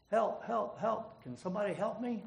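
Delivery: high, loud cries for help; hollering for help. A narrator's reading. Man hanging off a cliff hollering up for help. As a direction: yell up the cliff, clinging on. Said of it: "he hollers up".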